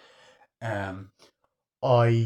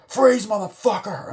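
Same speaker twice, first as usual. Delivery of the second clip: high, loud barked order; mock cop shout; 10 semitones higher, 6 dB louder than usual. Cop yelling at the gangster in his car, loud shout. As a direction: yell it like a cop drawing on him. Cop's gun line retold.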